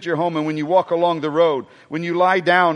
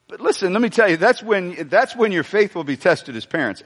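ordinary delivery

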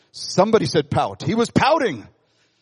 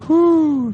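relieved whew; 10 semitones higher, 5 dB louder than usual. Retold or imitated. Jonah relieved in vine shade.

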